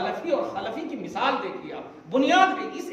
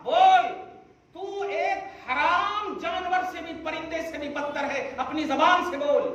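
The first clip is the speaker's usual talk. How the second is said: forceful command; 7 semitones higher than usual. Acted. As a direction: order him to say it himself. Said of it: "ये जबान से कहवाया".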